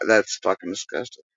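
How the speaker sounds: usual speaking voice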